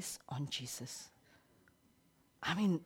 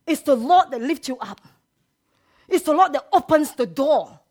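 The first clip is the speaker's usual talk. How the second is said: rising, louder and higher preaching; preaching at full force; 7 semitones higher, 10 dB louder than usual. Real, not acted. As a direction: build the crowd up, shouting praise.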